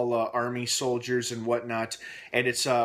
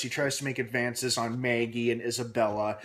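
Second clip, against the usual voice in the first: ordinary talk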